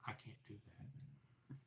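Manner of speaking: slow, breathless whisper; frozen hushed reply. Retold or imitated. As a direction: breathe it out slowly with no voice. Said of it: "And I went".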